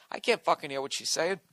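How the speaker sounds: normal talking voice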